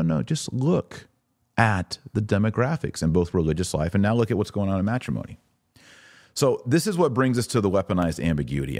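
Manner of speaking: usual speaking voice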